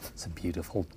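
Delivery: low, very soft Australian accent; hushed put-on Aussie voice; quiet Australian accent. Shown as a demonstration. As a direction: ease softly into an Australian accent. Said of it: "do my Australian accent".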